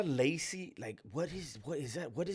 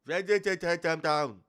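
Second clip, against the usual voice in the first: high cartoonish mimic, louder; vintage cartoon voice; 6 semitones higher, 8 dB louder than usual. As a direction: parrot the cartoon voice. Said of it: "Why is he talking like a cartoon character?".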